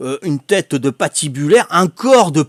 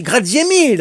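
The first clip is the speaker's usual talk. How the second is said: Italian thanks; 6 semitones higher than usual. Retold or imitated. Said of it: "je connais que ça, moi, en italien".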